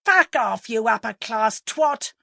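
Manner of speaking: loud, abusive yell. A narrator's reading. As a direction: hurl the insult at full volume. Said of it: "yelled Mister Parr".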